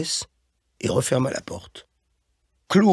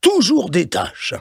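higher, exasperated rebuke; scolding grumble; 6 semitones higher, 4 dB louder than usual. A narrator's reading. Boss rebukes Claude for his stains, exasperated. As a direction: scold a clumsy underling, pitch raised. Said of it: "gronda l'autre".

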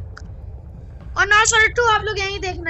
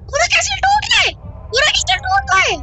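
high panicked cry as the castle falls; real panic at the collapse; 10 semitones higher than usual. Real, not acted. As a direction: shout it up high in real alarm.

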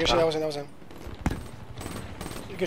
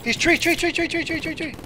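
urgent callout; 11 semitones higher, 4 dB quieter than usual. Real, not acted. Urgent callout of enemy position.

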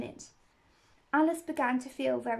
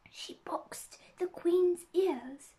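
slow, secretive whisper; whispered gossip; 6 semitones higher, much slower than usual. A narrator's reading. Rabbit confides scandal to Alice in a whisper. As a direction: whisper the scandal, taking your time.

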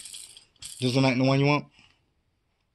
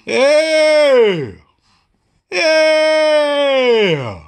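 long, loud high yeah; drawn-out yeah; 5 semitones higher, 5 dB louder than usual. Real, not acted. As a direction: stretch the cheer out. Drawn-out cheer.

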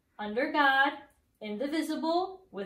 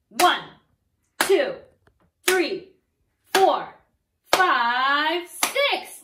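each number called out loud with a clap; loud counting; 7 dB louder, much slower than usual. Shown as a demonstration. Teacher leads the class clapping six times, counted loud. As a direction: count out loud and strong with the claps. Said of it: "clap your hands six times".

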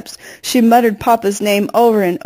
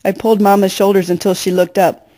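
ordinary delivery

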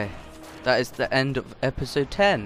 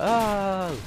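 a higher "Oh!" of pretend awe; hammed-up wow; mock gasp of amazement; 10 semitones higher, 3 dB louder than usual. Imagining a friend seeing the clip, mock amazed "Oh!". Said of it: "And I'll be like".

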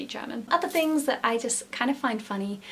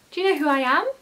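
bright, lilting question a notch higher; a light hint of a princess voice; playful princess line; 6 semitones higher, 5 dB louder, faster than usual. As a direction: ask it like a character teasing. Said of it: "she said".

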